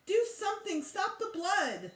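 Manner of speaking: high, panicked cry; begging for help in a panic. A narrator's reading. Child crying to Mom about blood, high and panicked. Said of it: "I cried".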